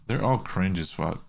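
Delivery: usual speaking voice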